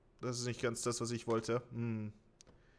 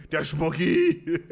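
slow laugh through the name; 4 semitones higher, 9 dB louder, much slower than usual. Real, not acted. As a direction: laugh it out loud.

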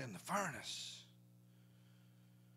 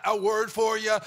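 ordinary delivery

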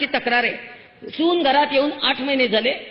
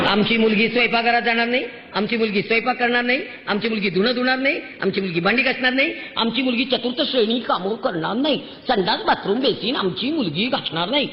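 sing-song recital, each नाही landing alike; mock the parents' repetitive list, swinging up and down on संडास, bathroom; imitated parents' chant of आमची मुलगी … नाही; mimicked list of refusals.

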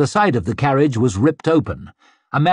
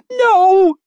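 very high, loud, stretched scream; terrified squeal; 7 dB louder than usual. A narrator's reading. Maid as the mob drags her inside, squealing. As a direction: squeal in horror. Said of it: "she squealed".

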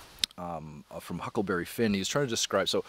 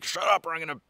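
higher-pitched bully's threat, lightly acted; mock threat; 6 semitones higher than usual. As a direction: act out a big guy threatening the singer. Acting big guy's threat to singer.

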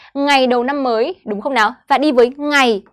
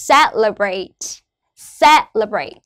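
"Celebrate" with CE- raised, -lebrate stepping down; stress on first syllable; 3 semitones lower, slower than usual. Shown as a demonstration. Teacher models word stress for students, CE- stressed in "Celebrate". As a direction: lift CE in "Celebrate", lower the rest. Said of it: "lên giọng ở âm đầu tiên này và xuống giọng".

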